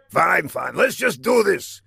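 impatient villain. Acted.

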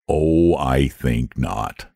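low, slow, unbothered drawl; lazy dragon voice. Acted. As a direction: shrug it off idly. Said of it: "in his lazy way".

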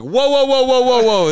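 high, loud string of whoas; mock whoa. Friend startled by cocky Gabe, high whoas. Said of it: "I'm like".

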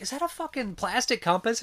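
high-pitched mock disbelief; incredulous question. Lost character questioning his guide in the woods, incredulous. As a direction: imitate a baffled character's question.

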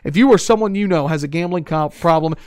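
upbeat sponsor ad read. Acted. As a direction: sell it like a radio spot. Reads a sponsor ad.

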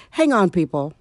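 ordinary delivery

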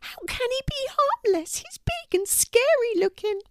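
indignant, very high squeak; high-pitched scared little mouse; exclaiming mouse. A narrator's reading. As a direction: protest in a tiny mouse voice. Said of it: "exclaimed the mouse".

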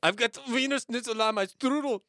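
high, loud mock German; bad German accent. Host demonstrating a silly German line. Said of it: "This is what I wanna hear you say".

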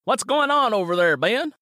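put-on young man calling to Ben across the road.

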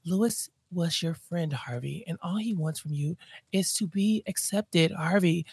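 soft, breathy mock lecture; mock hushed Donna. Donna lecturing Harvey in a soft mock hush. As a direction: whisper the guilt trip to him.

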